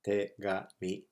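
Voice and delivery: "Tegami" split into te, ga, mi; syllable by syllable. Shown as a demonstration. Teacher introduces the word for letter, syllable by syllable. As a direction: sound out "Tegami" in three level, separate beats. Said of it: "Tegami means letter".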